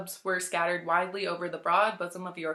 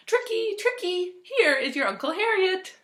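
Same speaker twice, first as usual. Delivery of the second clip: cry of delight; 12 semitones higher, 5 dB louder than usual. Acted. Elderly lady greeting her vet and dog, delighted high cry. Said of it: "put down her book with a cry of delight".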